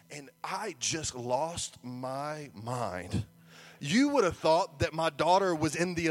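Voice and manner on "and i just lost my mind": slow, low, drawn-out admission; slow and weighty, pitched down; deliberate confession. Real, not acted. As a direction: stretch each word out before the punchline. Drawn-out confession before the punchline.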